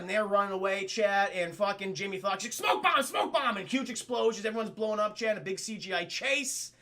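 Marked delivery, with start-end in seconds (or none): 2.5-3.6 high urgent repeated warning; urgent call. Retold or imitated. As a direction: sound the alarm, pitched up. [name] voiced shouting warning.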